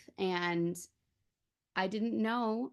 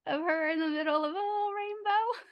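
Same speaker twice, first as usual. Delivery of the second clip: excited; 10 semitones higher, 10 dB louder than usual. Real, not acted. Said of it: "it's so cute".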